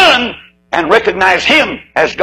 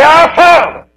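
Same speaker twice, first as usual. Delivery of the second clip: fervent prayer cry; 14 semitones higher, 5 dB louder, slower than usual. Acted. Boys taught to pray aloud.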